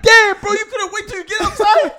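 hammed-up griping at a friend; playful exaggerated complaint; mock complaint. Retold complaint about car fart.

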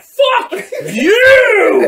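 high, loud, drawn-out yell; comic full-volume outburst; mock furious shout. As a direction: belt out an exaggerated cry of outrage. Imitating a character yelling at someone.

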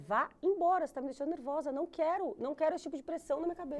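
high, pressured, fed-up protest; agitated outburst. Retold or imitated. New mother orders her own mother out, agitated and high. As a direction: reenact losing patience, firm and high-pitched. Retold quarrel sending mother away.

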